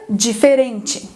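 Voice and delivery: "diferente" slowly, every vowel full; all vowels pronounced. Shown as a demonstration. Teacher contrasting Portuguese for learners, slow "diferente" with full vowels. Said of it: "a gente pronuncia todas as vogais".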